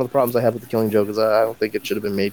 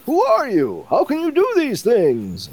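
high, astonished cop voice; a baffled police commissioner demanding answers; Commissioner Gordon voice. Retold or imitated. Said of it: "and he's like".

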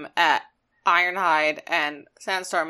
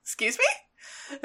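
mock offended Excuse me; 6 semitones higher, 8 dB quieter, much slower than usual. Starscream is offended.

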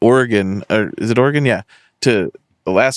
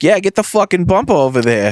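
put-on thick Boston accent; 8 semitones higher than usual. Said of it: "real thick Boston accent".

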